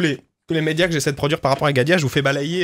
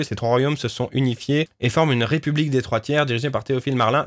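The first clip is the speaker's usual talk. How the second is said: usual speaking voice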